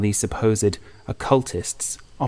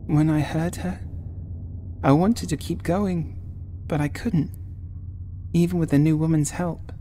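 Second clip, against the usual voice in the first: put-on higher, a touch tearful young woman; tearful Sadie; 8 semitones higher than usual. A narrator's reading. Sadie tearfully telling Oliver about Angela's voice, mild tearful. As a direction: speak through fresh tears, mildly. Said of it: "Fresh tears flowed down her face".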